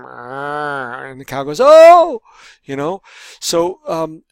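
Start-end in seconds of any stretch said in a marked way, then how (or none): 1.6-2.2 a sudden loud, high cow call; loud high moo. Retold or imitated. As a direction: belt out a silly cow noise. Cow sound imitated.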